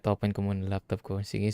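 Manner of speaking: ordinary talk, nothing marked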